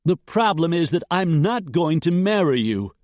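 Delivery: high, loud bitter retort; angry young woman. A narrator's reading. Jessica accusing Dietrich, bitter and loud. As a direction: throw it back at him.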